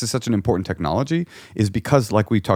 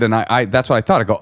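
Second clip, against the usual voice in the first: normal talking voice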